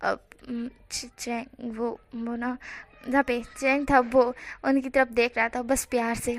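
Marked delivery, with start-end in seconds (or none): 0.0-2.6 soft, halting, embarrassed stammer; shy stammer. A narrator's reading. Groom embarrassed before his fiancé, softly stammering. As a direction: stumble over the name, too shy to speak up. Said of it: "शर्म से पानी पानी हो रहे थे".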